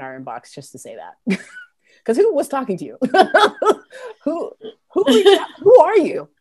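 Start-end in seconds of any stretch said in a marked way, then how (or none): 1.7-6.3 high, loud laughing disbelief. Real, not acted. Laughing at an angry inbox message, high and loud. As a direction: laugh through the question.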